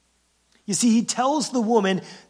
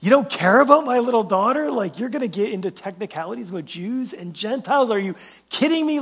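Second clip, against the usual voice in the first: mock outrage; 3 semitones higher than usual. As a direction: act out the frustrated mother, hammed up with a laugh. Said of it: "I would be like".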